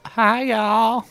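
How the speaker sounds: high squeaky little creature greeting; a tiny moss creature chirping hello. Acted. The creature speaks when asked.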